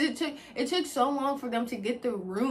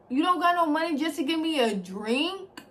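higher and louder, heated and accusing; angry confrontation; 6 semitones higher, 7 dB louder than usual. Retold or imitated. Telling Croc off for not buying a drink, furious. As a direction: let the anger build as you blame him.

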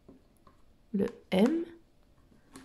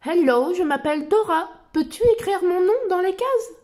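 put-on bright, high child explorer voice; Dora voice; 6 semitones higher than usual. Reading Dora's lines to little listeners, in a high cheerful voice. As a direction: lift the voice like Dora talking to kids.

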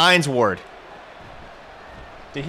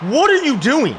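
exasperated yell; 9 semitones higher, 5 dB louder than usual. Real, not acted. Gamer berating Gronk for a wrong route, exasperated yell. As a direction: yell at him in disbelief, stretching the words. Berating his player mid-game.

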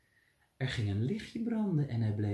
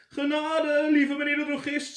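begging; 11 semitones higher, 3 dB louder than usual. Acted. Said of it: "smeekte Gijsbert".

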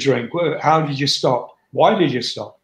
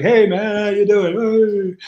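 put-on dragged-out drunken hello, pitched up; mock drunk greeting; 6 semitones higher, much slower than usual. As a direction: ham up a tipsy buddy saying hello. Mimicking drunk, happy backstage people.

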